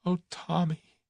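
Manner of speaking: high-pitched tearful wail; sobbing cry. A narrator's reading. Tuppence crying over Tommy's snapshot, sobbing. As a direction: cry out the name through sobs. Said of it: "burst into a fit of sobbing".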